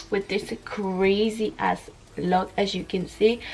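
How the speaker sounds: usual speaking voice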